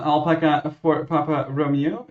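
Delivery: ordinary delivery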